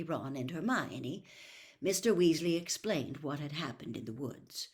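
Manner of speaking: ordinary delivery, nothing marked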